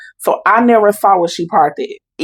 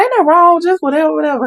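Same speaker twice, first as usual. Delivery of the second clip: dismissive relatives; 9 semitones higher, faster than usual. Retold or imitated. Relatives brushing off her worry.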